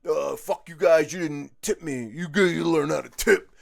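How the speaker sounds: loud, slow, put-on sweet scolding; mock nice scolding. Server mock-lecturing customers who didn't tip, slow and loud. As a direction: tell off non-tippers in a fake-nice voice. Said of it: "I did it in a really nice, genial way".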